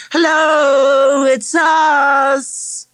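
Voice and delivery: high, drawn-out yell through a letterbox; excited girl yelling at the door. A narrator's reading. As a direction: holler a long, loud greeting. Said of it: "Zoe yelled through the letterbox".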